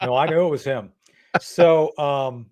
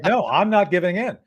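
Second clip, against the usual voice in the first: retold yelling back; 7 semitones higher, 3 dB louder than usual. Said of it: "starts yelling at me, and I start yelling back".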